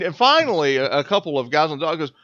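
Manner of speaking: normal talking voice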